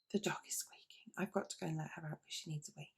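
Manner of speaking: quick hushed whisper to the viewers; hurried breathy whisper about letting the dog out; whispered aside. Real, not acted. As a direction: drop to a whisper so the dog won't hear. Aside while the dog squeaks.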